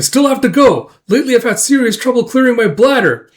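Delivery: high, loud mock insistence; insistent protest. Brother insisting he needs the bathroom, loud protest. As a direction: loudly push a feeble excuse.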